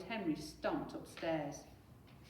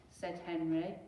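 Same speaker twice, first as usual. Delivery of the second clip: ordinary delivery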